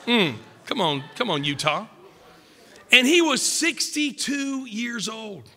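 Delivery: ordinary talk, nothing marked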